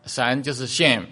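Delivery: Shem with a short e, not English shame; slow Shem, short vowel, not shame. Shown as a demonstration. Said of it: "不是英文那个shame".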